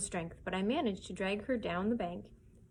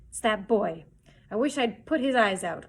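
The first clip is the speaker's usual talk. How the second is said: louder, vicious outburst; angry cry; 6 dB louder than usual. Acted. Blind Pew raging about the boy, angry cry. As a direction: curse the boy with venom. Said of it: "cried blind Pew".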